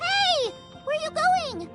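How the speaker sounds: high, startled shout of protest; indignant, high-pitched call; calling after someone. Acted. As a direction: call out as they walk away. Calls after listener walking off.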